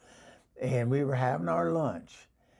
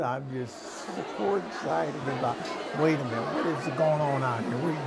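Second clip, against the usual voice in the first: ordinary talk, nothing marked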